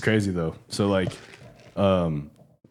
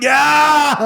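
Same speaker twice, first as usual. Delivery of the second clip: high cheering yell; crowd cheer; 7 dB louder than usual. Retold or imitated. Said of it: "everybody's there like".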